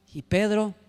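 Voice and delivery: normal talking voice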